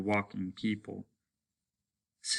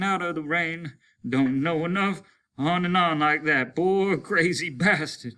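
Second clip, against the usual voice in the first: high, loud words beating with each stride; breathless grim tale on the run; panting in cadence; 8 semitones higher, 5 dB louder than usual. Acted. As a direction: tell it between breaths as you run. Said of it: "Simmons panted, the words in cadence to his running".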